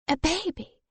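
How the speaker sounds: high, soft, stunned question; can't quite believe it; dazed. A narrator's reading.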